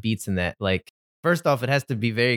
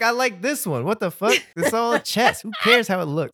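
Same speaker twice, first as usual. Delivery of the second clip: high-pitched goofy guy voice; a streamer defending his old chess game, high and goofy; high mock streamer voice; 9 semitones higher than usual. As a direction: pitch it way up like an annoyed gamer. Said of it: "He's like".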